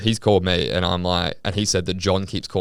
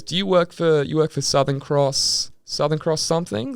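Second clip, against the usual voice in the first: imitated removalist; 9 semitones higher than usual. Voicing removalist who has googled him.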